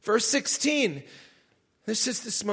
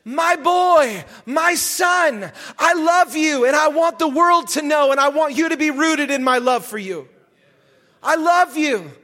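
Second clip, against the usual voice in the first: loud, raised declaration of love; proclaiming father; 3 semitones higher, 4 dB louder than usual. Acted. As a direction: declare it to a son with full force. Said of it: "The Father says".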